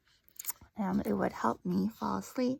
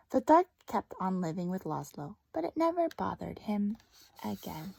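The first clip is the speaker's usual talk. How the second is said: story narration. Acted. Teacher reads story to class.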